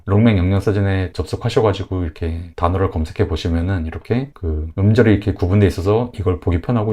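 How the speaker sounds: ordinary talk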